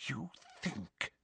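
a very soft, menacing breath; soft menacing whisper. Acted. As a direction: threaten in a whisper. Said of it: "she whispered".

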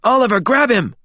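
high, urgent command; shrill, frantic. A narrator's reading. Alexander begs Oliver to stop James, shrill and frantic. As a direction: cry out frantically for help. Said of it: "shrill and frantic".

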